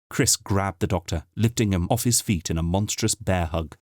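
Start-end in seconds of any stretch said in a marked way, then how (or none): none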